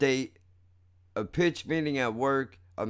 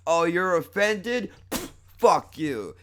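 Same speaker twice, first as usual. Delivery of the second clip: higher, louder, drawn-out mock offence; mocking retort; 7 semitones higher, 6 dB louder, slower than usual. Man spitting scorn at the comic, mocking retort. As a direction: throw out a scornful, dragged-out retort. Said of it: "That's what he says to me".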